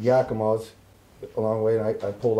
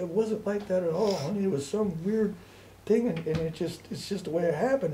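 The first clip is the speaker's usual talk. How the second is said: higher, softer pleading mother's voice; imitated emotional mother; 6 semitones higher, 4 dB quieter than usual. Mother assures son he was missed, imitated pleading. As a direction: plead gently in a raised mother's voice.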